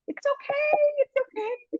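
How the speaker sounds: retold consoling. Order taker soothing a crying caller, high mock consoling.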